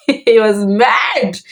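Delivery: laughing through it, drawn out slowly. Real, not acted. Recalling dad's fury to the audience, laughing slowly. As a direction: chuckle and stretch out "He was mad".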